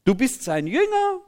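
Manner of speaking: high, loud outraged cry; shrieked indignation of an angry crowd; furious shouting. A narrator's reading. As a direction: shout it at him in acted disbelief. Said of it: "Jetzt werden sie richtig wütend".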